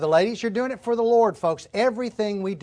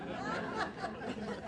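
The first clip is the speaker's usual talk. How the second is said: a short breathy laugh; soft unvoiced chuckle; light laugh; 14 semitones higher, 16 dB quieter than usual. Real, not acted. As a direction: laugh at yourself. Laugh after joke.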